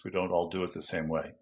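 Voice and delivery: normal talking voice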